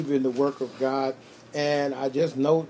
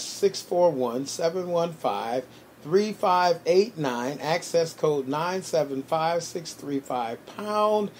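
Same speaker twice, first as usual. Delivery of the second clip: phone number read off briskly in running groups; kind of fast; 3 semitones higher, slower than usual. Real, not acted. Host giving listeners the call-in number, kind of fast. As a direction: rattle off the digits without long gaps. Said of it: "I said it kinda fast".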